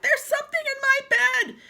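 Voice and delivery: high, loud shrieking wife; panicked scream. Acted. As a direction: shriek it in terror. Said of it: "screamed Missus Twit".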